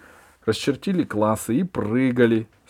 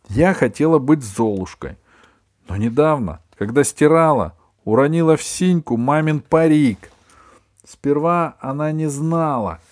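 put-on higher, louder girlish storytelling; animated child telling her story; excited girl; 6 semitones higher, 7 dB louder than usual. A narrator's reading. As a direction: pour out the mishap in a high voice. Girl excitedly confides costume mishap.

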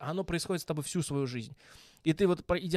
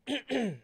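choking cough; 9 semitones higher than usual. Real, not acted. Still clearing throat after choking.

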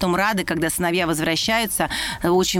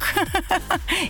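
high giggle; 10 semitones higher than usual. Real, not acted. Host giggles about serving boys.

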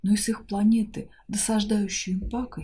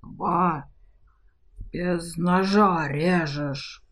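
slow, outraged dragon groan; indignant dragon; much slower than usual. A narrator's reading. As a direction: drag it out, wronged and outraged. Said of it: "возмутился свирепый".

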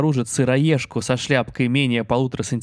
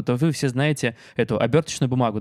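ordinary talk, nothing marked

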